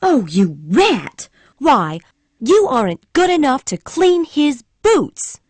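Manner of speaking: put-on an indignant girl's furious cry; furious outburst. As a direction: spit the insult at him. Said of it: "cried Scarlet, red with anger".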